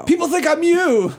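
high, squealed fan blurt; hammed-up fan gushing; mock starstruck fan. Host voices her accosting the star.

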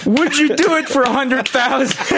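very high mock child voice; mock British kids. Co-host as kids making an offer, mock British child voice. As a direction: keep up the squeaky British kid impersonation. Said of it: "And they're like".

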